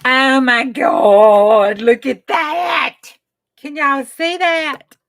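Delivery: slow, loud, high gasp of wonder; amazed delight. Real, not acted. Crafter showing viewers the result, slow thrilled exclaim. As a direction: draw it out, thrilled.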